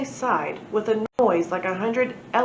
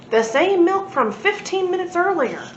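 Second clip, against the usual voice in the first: small globby alien; 9 semitones higher, 4 dB louder than usual. A narrator's reading. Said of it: "so green and small and so globby".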